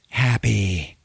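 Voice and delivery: a hint of a grown woman taking over the child; grown woman's voice. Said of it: "in this grown woman's voice".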